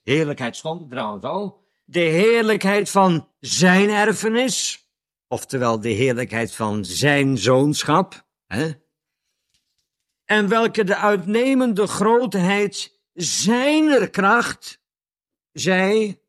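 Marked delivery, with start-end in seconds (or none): 1.8-4.9 slow reading, "zijn" lifted high and lengthened; stress on ZIJN. Shown as a demonstration. Preacher rereading the verse for listeners, stress on ZIJN. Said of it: "er staat met nadruk op zijn". 13.1-14.8 "zijner" drawn out slow and high; stress on ZIJNER. Shown as a demonstration. Preacher reading whose power it is, stress on ZIJNER. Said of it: "er staat met nadruk op zijn".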